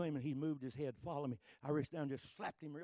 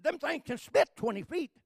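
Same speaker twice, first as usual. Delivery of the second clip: loud, high retold panic; alarmed shout; 9 semitones higher, 15 dB louder than usual. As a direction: bark it like a scared brother. Brother warning about a snake.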